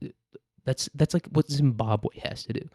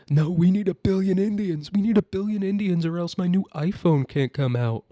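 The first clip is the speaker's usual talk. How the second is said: high, far-from-usual whiny mock voice; pitched-up parody of an entitled tech fan; mock complainer; 7 semitones higher than usual. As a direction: put on a high, pleading caricature. Mocking whiny voice of tech demanders.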